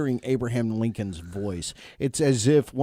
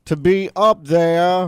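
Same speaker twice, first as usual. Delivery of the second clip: slow, louder Southern drawl; mock old coach; 5 semitones higher, 8 dB louder, slower than usual. Host parodies the coach's stadium speech, slow mock drawl. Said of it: "parodying the coach's speech".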